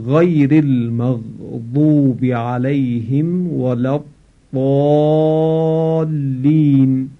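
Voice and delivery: slow chanted recitation with heavy ḍād in الضالین; Quran recitation. Shown as a demonstration. Teacher reciting from Surah al-Hamd in prayer style. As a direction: recite it slowly, drawn out like prayer. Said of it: "این کلمهٔ ولا الضالین با حرف ضاد".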